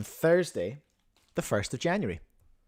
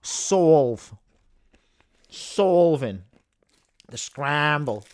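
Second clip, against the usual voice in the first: loud, drawn-out Boston vowels; Boston accent attempt; 4 dB louder, much slower than usual. Shown as a demonstration. Streamer testing cubing words for an accent that needs work, rough Boston accent. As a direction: try out Boston on single words. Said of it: "I might have to work on Boston".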